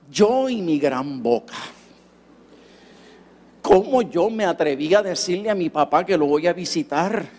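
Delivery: usual speaking voice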